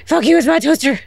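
loud mock-defiant cry over the toaster; defiant yell. Real, not acted. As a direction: yell it at the enemy, possessive. Defends toaster from enemy.

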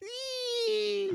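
very high, soft startled squeal like scattering roaches; mock squeal. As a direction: squeak in fake fright. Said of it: "Everyone goes".

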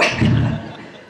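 long high laugh at his own joke; real laugh. Real, not acted. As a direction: laugh it out freely. Laugh continues.

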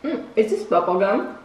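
talking while chewing candy; words around a mouthful; mouth full, chewing. Real, not acted. Seen 1: Dark-haired speaker bit a candy stick; mouth working while speaking.

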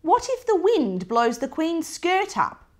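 demanding. Acted. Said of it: "demanded my brother Bertie".

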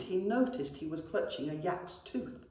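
usual speaking voice

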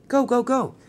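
high, loud urgent command; urging on. Acted. Percy sends Thomas off to hide, urging. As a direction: hurry them off with high, punched words.

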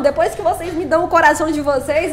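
normal talking voice

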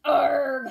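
loud growling arg; pirate holler. A narrator's reading. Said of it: "hollered Braidbeard".

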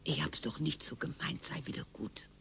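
low hushed plea to make up; wife whispering sweet apologies to husband; whispered coaxing. Acted. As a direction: whisper it close, coaxing him back. Wife sweet-talks husband after fight.

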